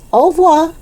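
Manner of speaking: high, lilting French "Au revoir"; bright-pitched teacher's farewell called out in French; calling after someone. A narrator's reading. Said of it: "she called after him".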